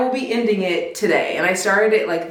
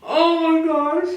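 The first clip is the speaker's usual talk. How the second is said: higher, slow and giggly; embarrassed groan through a laugh; overwhelmed by what she read; flustered; 8 semitones higher, slower than usual. Real, not acted. Flustered by spicy passage.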